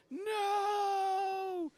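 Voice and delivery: long, drawn-out high wail; screaming men. Acted. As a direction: wail it out like desperate screaming voices. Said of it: "ten or twenty men just screaming".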